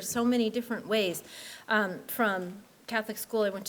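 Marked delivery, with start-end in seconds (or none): none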